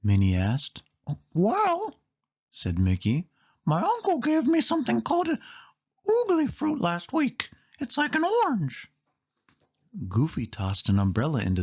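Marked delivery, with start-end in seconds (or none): 1.3-2.0 much higher cartoon mouse; a thoughtful squeaky well; Mickey voice. Mickey answers Minnie. 3.6-8.9 much higher, quick cartoon mouse voice; Mickey voice. Mickey explains the oogly fruit.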